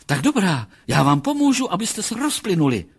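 high, loud acted resolve; determined outburst. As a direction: declare it as a rising threat. Psychologist resolves to shoot the men.

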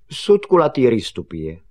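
loud, declarative answer, falling at the end; reply with solemn importance; serious pronouncement; grave voice. Acted. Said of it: "odpověděl vážným hlasem".

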